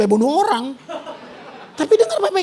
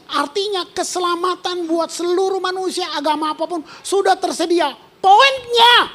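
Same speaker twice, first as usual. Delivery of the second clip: ordinary delivery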